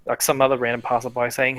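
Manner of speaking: normal talking voice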